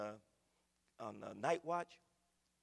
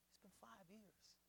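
much softer, slow, heavy echo; hushed repeat; 5 semitones higher, 22 dB quieter, slower than usual. Retold or imitated. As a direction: let the words sink in, barely said. Retelling grieving man's words.

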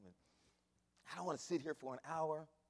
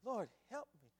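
put-on old sisters praying; 5 dB quieter than usual. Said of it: "listen to the old sisters over there".

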